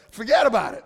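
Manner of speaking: loud New York "Forget about it"; brash New Yorker punch on "Forget about it"; a New York tough-guy brush-off, said loud; New York accent. Retold or imitated. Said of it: "that was pretty New Yorker of me".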